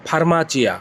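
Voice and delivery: normal talking voice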